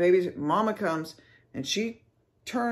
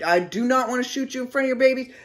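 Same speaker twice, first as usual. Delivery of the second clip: fast, high, urgent plea; retold screaming; 7 semitones higher, faster than usual. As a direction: rush it out as a raised-voice warning. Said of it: "I'm screaming this".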